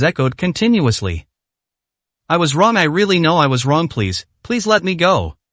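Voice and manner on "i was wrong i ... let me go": usual speaking voice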